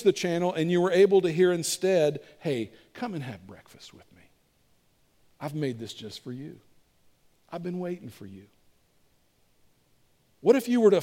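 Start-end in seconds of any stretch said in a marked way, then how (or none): 2.4-8.4 very soft, half-whispered welcome; tender hushed invitation. Acted. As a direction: invite them gently, barely above a whisper. Jesus's tender invitation, replacing accusing voice.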